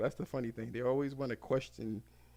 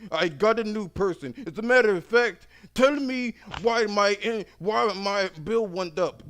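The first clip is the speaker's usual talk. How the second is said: higher, louder, furious customer shouting; higher angry customer yelling; 7 semitones higher, 7 dB louder than usual. Retold or imitated. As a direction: yell it at the call agent, outraged.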